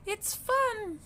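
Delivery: very high squeal of fun; a baby duck loving the water; delighted duckling. A narrator's reading. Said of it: "she cried".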